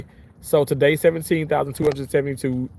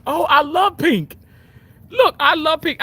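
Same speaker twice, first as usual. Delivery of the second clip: gushing delight; 14 semitones higher, 5 dB louder than usual. Real, not acted. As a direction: swoon over the colour pink. Gushing about pink shirt.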